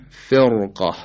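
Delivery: slow فرقه with a thick r; heavy ra. Shown as a demonstration. Teacher giving an example, heavy r in فرقه. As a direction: make the r in فرقه full and heavy.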